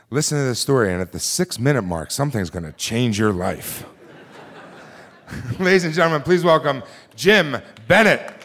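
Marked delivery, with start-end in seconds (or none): 5.6-8.3 loud, high laughing introduction; announcer intro. Acted. Host introducing Jim Bennett to the crowd, loud laughing announcement. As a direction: announce the next teller with a laugh.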